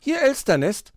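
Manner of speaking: high, chirpy phone greeting; magpie answering phone. Acted. Magpie picks up the ringing phone, high greeting.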